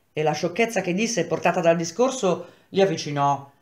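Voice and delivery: plain reading voice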